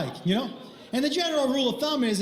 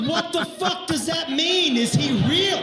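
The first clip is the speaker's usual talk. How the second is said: outraged disbelief; 5 semitones higher than usual. Retold or imitated. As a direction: demand it as an exasperated wife. Wife's outraged reply, acted.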